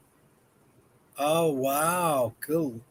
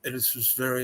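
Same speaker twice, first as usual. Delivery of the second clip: lightly imitating European Portuguese speech; a hint of European Portuguese accent; mock European Portuguese; 5 dB quieter than usual. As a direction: voice European Portuguese, clipped and closed. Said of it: "they talk like this".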